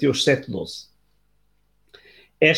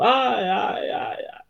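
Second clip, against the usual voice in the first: distant echo, high, then fading to a breathy trail; a far-off voice repeating and dying away; echo from the cave; 7 semitones higher, 4 dB quieter, much slower than usual. Acted. The cave's echo answering the boy, high and fading away. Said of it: "uma voz vinda das profundezas da gruta a repetir".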